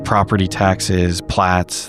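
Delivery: ordinary talk, nothing marked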